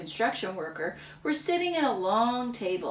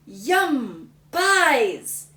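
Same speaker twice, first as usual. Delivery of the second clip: louder, higher glee; delighted kid; 4 semitones higher, 7 dB louder than usual. Acted. Little brother cheering for the pies, delighted. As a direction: cheer it greedily.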